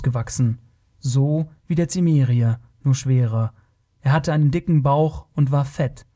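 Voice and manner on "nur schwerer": ordinary delivery, nothing marked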